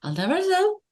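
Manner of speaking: Scottish, ending on a rising note; a Paisley accent with "tell" rising; Glasgow lilt. Shown as a demonstration. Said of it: "I always end on an up note".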